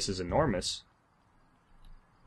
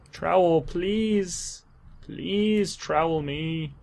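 drawn-out, higher-pitched begging; pleading whine; 7 semitones higher, much slower than usual. Pretend. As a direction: plead for it, stretching out each word. Begging the game for a trowel.

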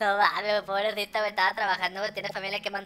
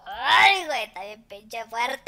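loud yelp as the shot burns, then a slow groan; burning tequila yelp; 3 semitones higher, 9 dB louder, much slower than usual. Acted. After a tequila shot, a loud pained yelp. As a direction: cry out at the strong tequila. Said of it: "Ando bien pedo".

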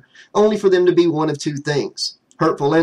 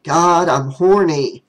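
frustrated groan; 2 semitones higher, 4 dB louder, slower than usual. Acted. Jimmy slumped, frustrated.

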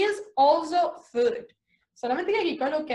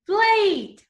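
higher and louder after spelling; cheerful word; 7 semitones higher, 5 dB louder than usual. Shown as a demonstration. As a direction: finish the spelling with a bright flourish. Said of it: "let's spell plate".

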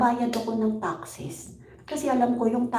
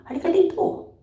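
calling out; 7 semitones higher than usual. Real, not acted. Calling a viewer over.

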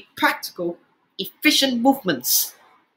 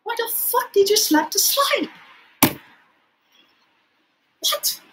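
very high, incredulous protest; high-pitched exasperation; 10 semitones higher than usual. Real, not acted. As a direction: ask it in high, exasperated disbelief. Protesting at the slide slap.

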